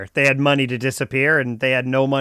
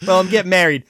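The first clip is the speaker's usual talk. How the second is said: ordinary talk, nothing marked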